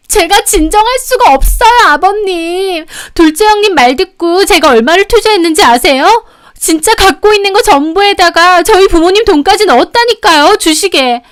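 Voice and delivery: high, loud frantic appeal; distraught yelling. A narrator's reading. Youngest sister-in-law appeals to father-in-law, distraught yelling. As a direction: plead your grievance at a shrieking pitch.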